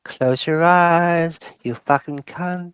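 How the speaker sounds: mock lullaby in a lightly voiced Aussie accent, slow at the start; Australian lullaby. Rough Aussie dad tucking his mate in, mock lullaby. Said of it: "Australian lullaby".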